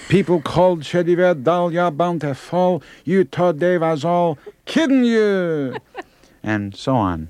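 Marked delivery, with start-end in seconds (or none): 0.1-5.8 put-on high, slow old Yiddish Dylan; old [name] Yiddish accent. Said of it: "the eighty-six-year-old [name]".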